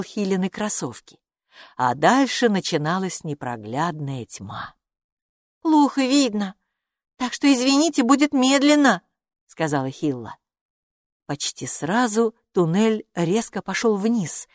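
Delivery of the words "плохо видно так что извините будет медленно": put-on raised girlish character voice; high, matter-of-fact character line; Hilla's warning. A narrator's reading. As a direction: warn them in a higher voice. Hilla warns the group.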